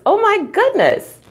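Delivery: teacher hamming up amazement; mock shock. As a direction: play up the shock for the kids. Mock shock at six sodas.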